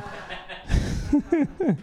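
high giggle. Real, not acted. Laughs at own joke.

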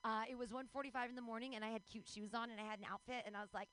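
ordinary talk

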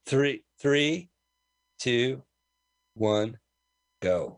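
slow spaced countdown; one number at a time, even pauses; paced countdown. Shown as a demonstration. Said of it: "[name], do the count right".

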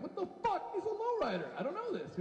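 high-pitched incredulous outburst; comic bafflement. Acted. Mexican asked about his favorite lowrider, baffled.

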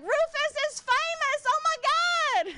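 very high, drawn-out squeal of excitement; hammed-up squeal, stretched long; mock excited nieces. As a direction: imitate thrilled little nieces, shrieking high. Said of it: "My nieces get so excited".

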